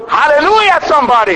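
high-pitched preacher's shout of praise; exultant call-out. Real, not acted. Preacher rousing the congregation to respond, exultant call. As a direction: call the congregation to answer you.

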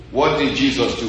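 ordinary talk, nothing marked

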